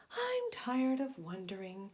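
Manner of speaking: lightly voiced Cyrus, fed up with drifting; bored sea serpent. Sea serpent complaining to himself, lightly voiced grumble. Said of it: "said Cyrus one day".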